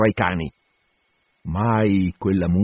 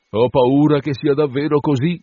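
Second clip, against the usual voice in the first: ordinary delivery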